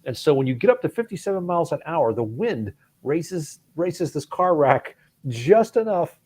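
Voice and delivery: radio host. Retold or imitated. Car show host explains the whistle to the caller, mild Boston voice. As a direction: explain it like a know-it-all mechanic.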